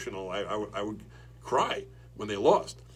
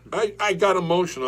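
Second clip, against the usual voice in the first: loud; 10 semitones higher, 11 dB louder than usual. Real, not acted. Defensive, loud protest.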